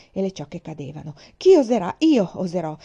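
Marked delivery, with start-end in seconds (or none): 1.4-2.8 loud, frenzied battle cry; fierce chanted defiance; defiant shout. Acted. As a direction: shout it while cutting wildly. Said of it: "Tagliai e tagliai sempre più in fretta".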